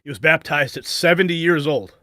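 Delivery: emphatic restatement. Real, not acted. Host marvelling after the baptism clip, loud and emphatic.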